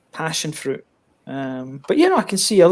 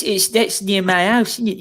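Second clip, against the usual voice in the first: put-on Scottish accent; 4 semitones higher than usual. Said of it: "certain words I can't say in that accent".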